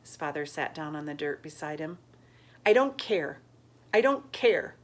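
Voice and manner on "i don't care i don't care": higher, louder protest; crying out. Acted. Grieving boy to his father, louder protest. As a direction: fling it out through grief.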